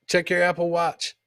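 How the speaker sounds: normal talking voice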